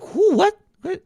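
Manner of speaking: high, hammed-up confused "Who? What?"; mock bafflement. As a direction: play dumb, squeaky and puzzled. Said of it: "I'd ironically just go like".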